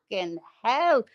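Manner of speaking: normal talking voice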